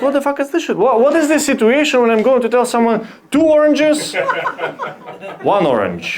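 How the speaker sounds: high, incredulous rant at the app; baffled outrage, pitch way up; indignant disbelief. Retold or imitated. Learner ranting at Duolingo's odd sentence, baffled outrage. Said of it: "I was like".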